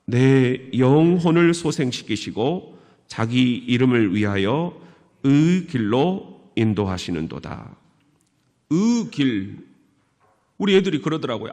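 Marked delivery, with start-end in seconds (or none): 0.1-7.8 slightly louder Gyeongsang-accented recitation, only lightly marked; dialect scripture reading. Shown as a demonstration. Pastor reading the psalm aloud, lightly Gyeongsang-accented. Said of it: "시편 이십삼 편 오늘 삼 절 말씀 시작". 8.7-9.7 의 길 said as one flattened syllable; dialect pronunciation. Shown as a demonstration. Children ask their dad to say it, he flattens 의의. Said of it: "의의 길 한번 해봐".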